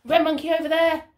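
ordinary delivery

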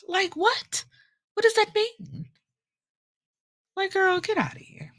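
high, mock-incredulous squeal; incredulous girl. Telling viewers about the schemers, high disbelieving mock squeal. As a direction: act out disbelief at a silly scheme.

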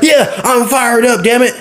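hammed-up wrestler promo shout; Bron Breakker. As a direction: imitate a fired-up meathead wrestler. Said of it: "Bron Breakker's gimmick is a meathead who is fired up".

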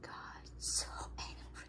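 hushed whisper; whispered comment on the cat. Real, not acted. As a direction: whisper it to the side. Whispering about sulking cat.

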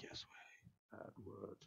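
barely audible mock-solemn whisper; imitated hushed reply. Player answers imagined viewers, hushed reply. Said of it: "And I'd be like".

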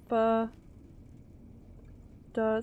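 syllable by syllable, BA, then DA; sounding it out. Shown as a demonstration. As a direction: break the word into separate Ba- and da. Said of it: "say it with me".

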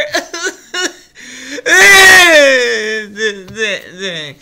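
long, loud fake bawl; mock crying wail. Pretending to be a heartbroken viewer, hammed-up crying. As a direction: wail high, then sputter out in sobs.

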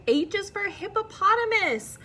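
high, loud grand announcement; triumphant reveal to a child; excited reveal. Acted. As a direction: unveil the answer with a flourish.

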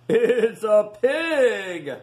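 loud, slow, high pirate cry; gruff shouting first mate. Acted. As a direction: bellow it from the rail. First mate spots Rufus.

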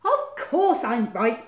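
loud, slow, self-satisfied badger; very sure of himself; beaming Badger. A narrator's reading. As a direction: draw it out proudly and loudly. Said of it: "beamed Badger".

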